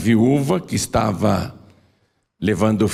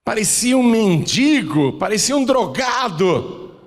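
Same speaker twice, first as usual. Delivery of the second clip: emphatic preaching; 11 semitones higher than usual. Acted. Emphatic description of the son.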